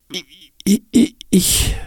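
hohes, stockendes Stottern. Acted. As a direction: stolpere über den Anfang. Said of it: "stotterte Nick". (Nick stottert verängstigt)

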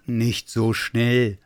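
very slow, steady bear voice; unhurried, settling pace; slowing someone down. Acted. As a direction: draw it out to calm a friend. Bear slows down breathless Santa.

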